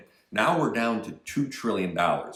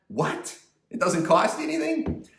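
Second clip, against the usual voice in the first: incredulous. Acted. Mocking incredulous repeat of claim.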